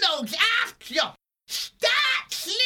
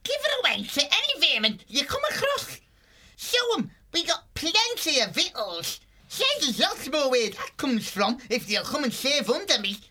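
fox boss snapping, quick at the start; exasperated villain chief giving orders; impatient. Acted. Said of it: "Badred snorted impatiently".

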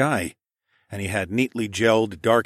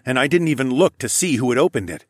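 ordinary delivery

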